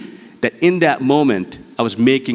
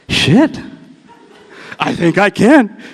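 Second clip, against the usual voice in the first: high, excited burst of disbelief; realising he just might do it, lit up; high startled "shit" then slow wonder; surprised realisation; 8 semitones higher, slower than usual. Retold or imitated. Sudden realisation he can walk.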